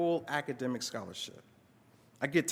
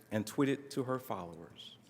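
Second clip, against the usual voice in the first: ordinary delivery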